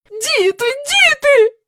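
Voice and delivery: high, rising despairing call; mother despairing at noisy children; desperately. A narrator's reading. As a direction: cry out in despair. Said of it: "розпачливо заломила руки".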